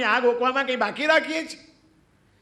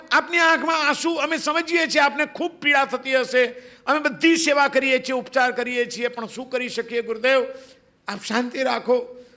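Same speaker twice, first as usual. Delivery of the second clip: high, pleading voice of devoted disciples; 3 semitones higher than usual. Acted. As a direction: beg the weeping guru to stop crying. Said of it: "એના વિનીત શિષ્યો".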